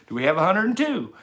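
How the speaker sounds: high, loud auctioneer call. Pretend. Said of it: "I sound like an auctioneer there for a second".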